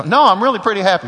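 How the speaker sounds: playful protest that you're actually happy; mock cheerful protest. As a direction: brush it off brightly and fast. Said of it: "And I go".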